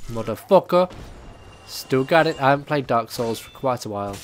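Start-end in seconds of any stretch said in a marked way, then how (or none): 0.1-0.9 startled curse. Real, not acted. Reacting to enemy ambush.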